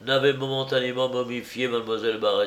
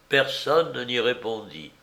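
ordinary delivery

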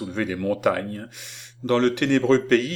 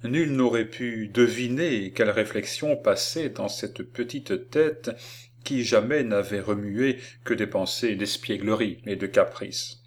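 ordinary delivery

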